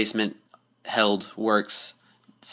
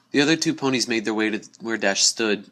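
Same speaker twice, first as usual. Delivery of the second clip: ordinary delivery, nothing marked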